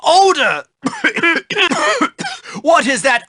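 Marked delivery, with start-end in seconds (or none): 0.8-2.6 high strained cough; choking cough. Acted. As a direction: gag on the smell. Coughing at a foul odor.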